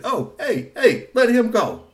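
high, loud, slow squeak; a toy telling robots to let go; squeaky toy voice. A narrator's reading. Squeaky Toy demanding release, high and squeaky. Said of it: "the Squeaky Toy said".

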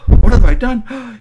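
high, very loud, drawn-out cry of horror; anguished outcry. Acted. Robot horrified at harming a human, anguished. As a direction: wail it in dismay at yourself.

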